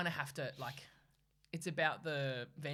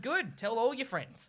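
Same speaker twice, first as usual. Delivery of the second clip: raised, slow, mock-gracious retort; defiant send-off; 6 semitones higher, 4 dB louder, slower than usual. Cafe owner to departing customer, drawn-out defiance. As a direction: relish getting rid of them.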